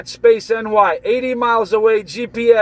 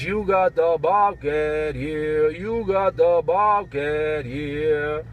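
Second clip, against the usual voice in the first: lower, slow, softer put-on buddy voice; imitating Bobcat; 5 semitones lower, 4 dB quieter, slower than usual. Lower, slow mimicry of a buddy for the CB listeners. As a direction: drop low and drag the words out like your radio pal. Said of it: "Let me do it again".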